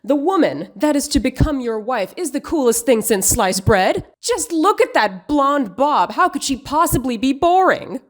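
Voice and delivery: sarcastic mock outrage, overdoing every phrase; mock overreaction. Commentator scolding Kumail over his film scene, mock overreaction. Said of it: "I'm overreacting".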